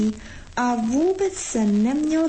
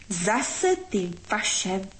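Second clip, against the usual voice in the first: through gritted teeth; 2 semitones lower, 4 dB quieter, slower than usual. A narrator's reading. Frost resents their Christmas, slow gritted-teeth grumble. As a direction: squeeze the words out slowly through clenched teeth. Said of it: "procedil skrz zuby mráz".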